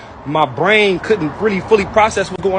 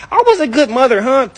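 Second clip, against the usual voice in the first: high, mocking voice of a self-pitying mother; mocking mom; 6 semitones higher, 3 dB louder than usual. Son mocking his mother's complaint to his aunt, high and snide. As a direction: mimic mom's hurt protest. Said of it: "she'd call one of my aunties like".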